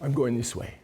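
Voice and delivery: lightly attempted Brazilian accent, pitched low. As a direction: try a mild Brazilian accent, low and understated. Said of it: "That's my best Brazilian accent".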